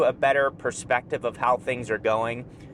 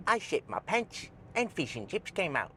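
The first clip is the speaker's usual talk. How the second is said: mock British accent; 4 semitones higher, 9 dB quieter than usual. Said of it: "'Cause you go like this".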